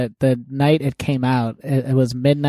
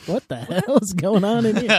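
baffled stall neighbour; 7 semitones higher than usual. Retold or imitated. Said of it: "the person in the other stall".

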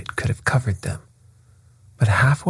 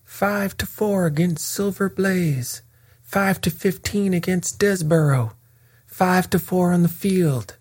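bookmakers' roar; 10 semitones higher than usual. A narrator's reading. Betting ring calling odds over the racecourse, a high roaring cry. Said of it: "roared the ring".

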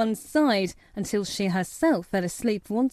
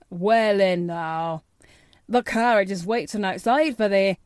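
put-on Yorkshire accent; 3 dB louder than usual. A narrator's reading. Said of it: "pronouncing his words in a queer, broad fashion".

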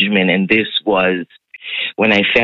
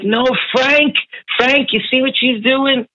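high-pitched mock alarm, calling for backup; mad. Imitating Nana complaining to Frank about their daughter, mad. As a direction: imitate an indignant wife complaining to her husband, voice way up. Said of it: "Nana was so freaking mad".

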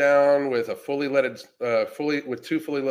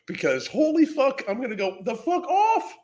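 hyped rant; 12 semitones higher than usual. Real, not acted. Hyped about going off online.

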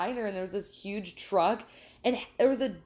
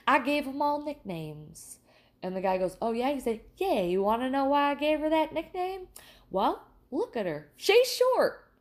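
put-on old man joke; 4 semitones higher than usual. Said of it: "the old man goes".

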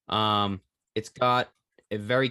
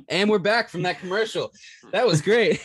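high, bright announcer comeback; excited host; 9 semitones higher, 4 dB louder than usual. Pretend. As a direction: bounce back in like a host. Mock host return after ad.

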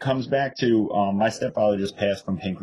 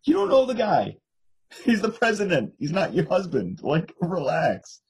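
much higher, squeaky disbelief that slows on relax; incredulous calm down; 9 semitones higher than usual. Retold or imitated. To her aunt raging about Trump, very high and incredulous. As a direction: talk her down from hysteria in mock amazement. Retells reply to hostile aunt.

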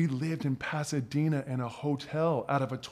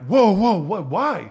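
shocked protest; 6 semitones higher, 9 dB louder, slower than usual. Retold or imitated. Landlord declines him.

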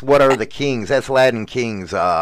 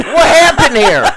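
high, loud, laughing mock question; a laughing, hammed-up what happened; laughing echo; 12 semitones higher, 6 dB louder than usual. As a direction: toss it back through a laugh.

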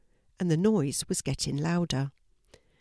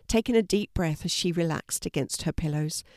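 ordinary delivery, nothing marked

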